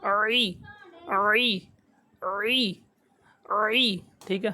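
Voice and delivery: عی squeezed from the mid throat. Shown as a demonstration. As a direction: wring out عی from the throat. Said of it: "اپنی آواز کو نچوڑنا ہے".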